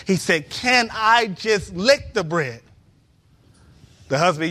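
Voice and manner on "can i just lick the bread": slow plea. Acted. Beggar's last plea.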